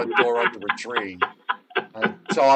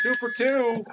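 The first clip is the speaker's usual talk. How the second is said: excited; 11 semitones higher, 6 dB louder than usual. Real, not acted. Excited after correct guess.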